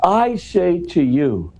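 I said high and slow, leaning on I; slow solemn I say to you, pressing I; stress on I. Shown as a demonstration. Explaining Jesus' emphatic ego to the interviewer, stressing I. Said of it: "I'm putting the emphasis on the me here".

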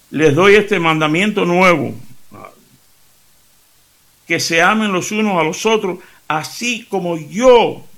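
proclaiming loudly. Shown as a demonstration. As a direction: preach it out with full force. Proclaiming Jesus' commandment.

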